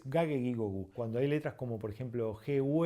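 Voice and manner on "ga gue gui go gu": "ga, ge, gi, go, gu" all with hard g; Latin G sounds. Shown as a demonstration. Teacher correcting the Spanish way, hard g in "ga, ge, gi, go, gu". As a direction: run through "ga, ge, gi, go, gu" keeping g hard. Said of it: "sino que es".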